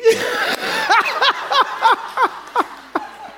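very high, long helpless laugh; shrieking laugh. Real, not acted. Pastor cracking up at his friend's joke, shrieking laughter. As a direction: let a big high laugh run on.

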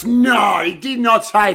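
high-pitched cry of disbelief; can't believe he said it; incredulous outburst. Real, not acted. Disbelief at the retold remark.

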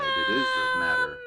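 hammed-up sob, cracking high on the end; mock crying protest. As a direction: wail it like a grieving friend. Player protests friend's death.

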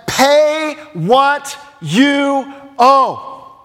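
slow, hard, high-pitched demand; choking demand. Acted. Servant choking a fellow servant over a debt, forceful demand. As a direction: punch out each word with long stretched force. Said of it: "started choking him".

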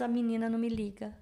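usual speaking voice

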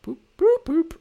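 high rising beeps; Skype call sound. Retold or imitated. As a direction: chirp it like a Skype hang-up tone. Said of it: "Ooh, I love that sound".

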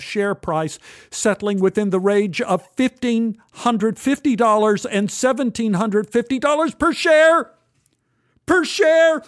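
incredulous, swelling read-out; mounting excitement. A narrator's reading. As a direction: voice climbing high and loud. Reading huge share price aloud.

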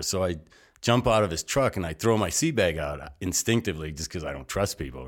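ordinary delivery

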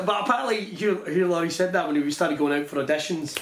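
ordinary talk, nothing marked

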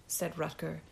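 usual speaking voice

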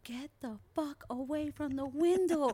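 loud, high, drawn-out acted yell. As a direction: yell the warning slowly, hitting it hard. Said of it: "we always will yell at him".

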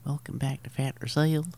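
put-on high, girlish southern-accented voice; high-pitched mock southern kid hosting a show; Ellie, southern accent. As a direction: badly imitate a young daughter with a southern twang. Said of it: "I don't know why she has a southern accent".

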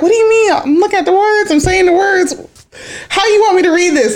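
high-pitched defensive protest; indignant protest. Retold or imitated. Retelling own protest to kids.

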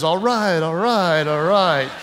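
a light, lazy hint of Matthew McConaughey. Preacher doing a quick McConaughey impression, lightly voiced. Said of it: "Matthew McConaughey says".

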